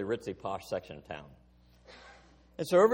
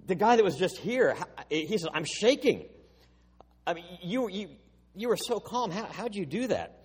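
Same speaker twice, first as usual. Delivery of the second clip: higher, breathless retelling of a shaken colleague; rattled coworker; 4 semitones higher than usual. Retold or imitated. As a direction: play the guy still shaking from the encounter. Coworker Keith retold, rattled.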